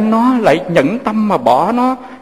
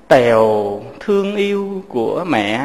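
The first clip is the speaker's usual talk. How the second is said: tender letter reading; 6 semitones lower, slower than usual. Acted.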